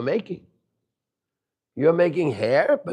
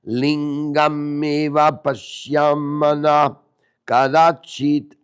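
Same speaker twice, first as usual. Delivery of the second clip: slow, measured Sanskrit verse recitation; reciting a holy verse, slow and deliberate; scripture recitation; 5 dB louder, slower than usual. Acted. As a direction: intone the verse slowly and solemnly.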